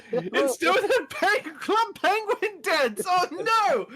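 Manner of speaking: high, slow, squealing delight; excited squeal. Real, not acted. Player squealing at a dancing frog, high and delighted. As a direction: squeal it out in giddy disbelief.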